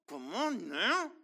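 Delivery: stretched out, slow, settling; a slow drawn-out follow-up; slow drawn-out echo. Real, not acted. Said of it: "repeated, drawn out".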